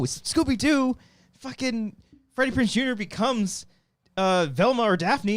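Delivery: high, loud excited outburst. Real, not acted. Friend excitedly recalling the Scooby-Doo movie scene. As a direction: burst out with delight.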